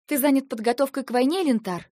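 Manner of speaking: Eva challenging Lentar over the coming war, sharp and accusing; sharp accusation. Acted. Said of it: "Не сдержалась я".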